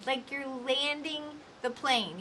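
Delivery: slow, landing the plane; winding down slowly like a landing. Shown as a demonstration. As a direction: ease the words down to a stop. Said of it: "slow down towards the end".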